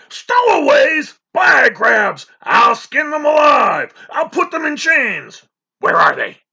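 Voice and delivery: high, loud raging shouts; furious roaring. A narrator's reading. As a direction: rage and threaten at full voice. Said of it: "he roared".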